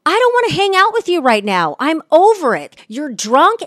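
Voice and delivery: fed-up retort. Retold or imitated. Telling drunk guy off.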